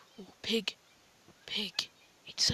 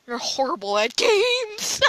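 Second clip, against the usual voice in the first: teasing shout cracking with laughter; mock him while laughing; laughing taunt. Real, not acted. Laughing at the seeker.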